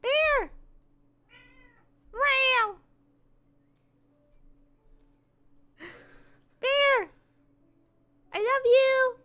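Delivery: high sing-song call to a pet; calling the cat. Real, not acted. Streamer calling her cat in a high, cooing voice.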